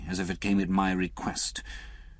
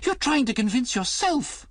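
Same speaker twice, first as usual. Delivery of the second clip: much higher, loud cry; 5 dB louder than usual. A narrator's reading. Liz crying out at Alec in protest. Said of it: "Liz cried".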